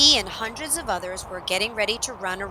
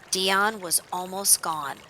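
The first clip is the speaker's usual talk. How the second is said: usual speaking voice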